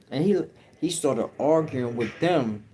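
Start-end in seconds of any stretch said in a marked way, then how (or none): none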